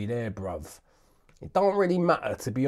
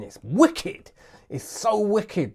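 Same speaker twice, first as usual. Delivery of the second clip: much higher, delighted and drawn out; excited; 9 semitones higher, 5 dB louder, slower than usual. Real, not acted. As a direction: gush over the sound. Excited praise of the snare.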